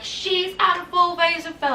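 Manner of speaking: put-on high voice of online critics; mock accusation. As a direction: imitate gossipy people making an accusation up high. Mimics commenters' accusation, then denies it.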